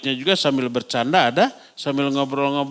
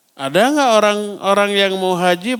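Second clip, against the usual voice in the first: usual speaking voice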